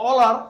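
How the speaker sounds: all are punched loud; emphatic mnemonic. Shown as a demonstration. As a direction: hammer all are home for the class. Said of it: "আর ই আর". (জোর দিয়ে all are)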